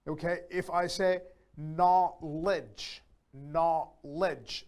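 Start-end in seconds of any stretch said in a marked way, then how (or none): 1.6-3.0 KNOWLEDGE in two separate beats; split into syllables. Shown as a demonstration. Teacher counting parts for a learner, split into syllables. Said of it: "that's two parts". 3.3-4.6 KNOWLEDGE with a gap mid-word; split into syllables. Shown as a demonstration. Teacher showing two parts, split into syllables. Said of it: "that's two parts".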